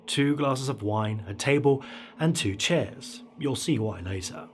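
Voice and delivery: usual speaking voice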